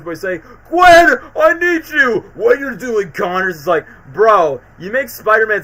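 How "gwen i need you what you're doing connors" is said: loud, high mocking shout; deep raspy voice. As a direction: yell it like a gruff hero. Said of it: "with that deep-ass raspy voice".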